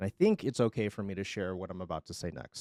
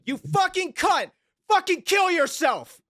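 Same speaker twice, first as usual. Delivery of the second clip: screaming at the top of his lungs, much higher and louder; 7 dB louder, slower than usual. Retold or imitated. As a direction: scream it with everything you've got, furious. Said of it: "him scream at the top of his lungs".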